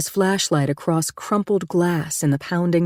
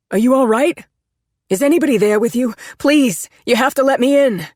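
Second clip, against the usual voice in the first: raised, high anxious plea; urgent pleading; 8 semitones higher, 4 dB louder than usual. Acted. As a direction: beg to be let in over the storm. Pleading through door in storm.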